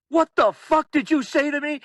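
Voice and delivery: high, loud mock outrage; furious confrontation. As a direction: act out someone exploding at an insult. Retold furious confrontation before a brawl.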